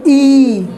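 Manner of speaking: "ई" stretched long and steady; vowel from the throat. Shown as a demonstration. Teacher says it along with pupils, sustained model vowel. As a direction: hold "ई" as a pure throat sound. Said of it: "यहाँ से गले से".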